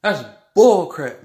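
loud, slow indignant declaration; angry. Real, not acted. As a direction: spit it out, fed up. Ranting against injustice.

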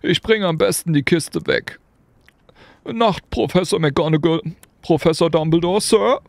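a tearful giant saying goodnight; Hagrid. A narrator's reading. Hagrid saying goodnight to the professors, choked up.

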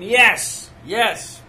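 mock crowd shouting yes; crowd yelling yes. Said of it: "They scream".

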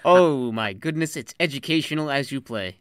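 loud exclamation. Real, not acted. Reacting to blood card.

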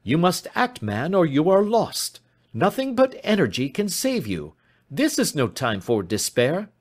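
high, forceful exhortation; urgent cry. Acted. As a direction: rouse him to act, pitch well up. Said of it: "cried Sherlock Holmes".

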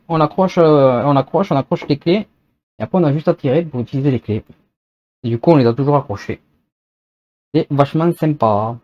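normal talking voice

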